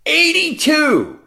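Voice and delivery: drawn-out high exclamation; high, slow burst of amazement; amazed. Real, not acted. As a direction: marvel at the age, stretching it out. Marvelling at the skater's age.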